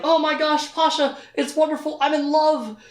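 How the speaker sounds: high, gushing delivery; lovestruck excitement. Acted. Richard telling Pasha he's in love, high giddy gushing. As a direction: gush like someone giddy in love.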